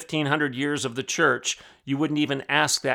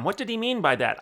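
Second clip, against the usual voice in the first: ordinary talk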